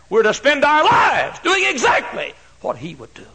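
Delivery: high and loud; preaching shout. Acted. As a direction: drive it home from the pulpit.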